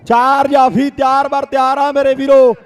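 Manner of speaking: rapid village kabaddi play-by-play; frantic rural sports commentary. Real, not acted. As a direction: call every raid live to the crowd. Second commentator calls raids. (ਤੇਜ਼ ਪੇਂਡੂ ਖੇਡ ਕੁਮੈਂਟਰੀ)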